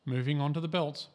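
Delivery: ordinary delivery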